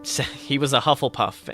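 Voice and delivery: ordinary delivery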